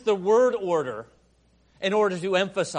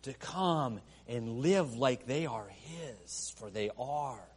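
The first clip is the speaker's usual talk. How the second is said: softer, slower, lower pastoral tone; tender and slow; 7 semitones lower, 11 dB quieter than usual. Acted. Preacher invites the church home, tender and slow. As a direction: draw them in gently. Soft slow sermon point.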